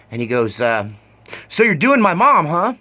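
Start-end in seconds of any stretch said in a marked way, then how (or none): 1.5-2.7 louder, higher retold accusation; imitating a friend's loud, pointed demand; mock confrontation. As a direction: throw it out as an imitated angry challenge. Angry friend confronts him while driving.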